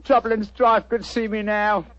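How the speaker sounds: cockney disguise. Acted. Disguised detective greets the homeowner in cockney. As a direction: play a cheeky London workman. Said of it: "That's rhyming slang".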